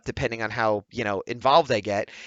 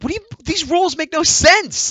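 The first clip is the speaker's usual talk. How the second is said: very high, louder outburst; exasperated protest; 6 dB louder than usual. Retold or imitated. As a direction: retell it as an indignant squawk. Acts out arguing over rules.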